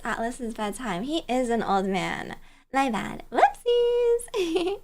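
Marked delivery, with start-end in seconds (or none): 3.3-4.8 squeaky whoopsies. Real, not acted. Apologizing to sleepy viewer.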